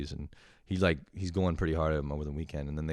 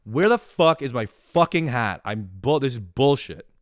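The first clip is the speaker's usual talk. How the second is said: much louder and higher, mock furious yell; hammed-up rage at the missing hat; 7 semitones higher, 9 dB louder than usual. Shown as a demonstration. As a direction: yell it like you've lost it.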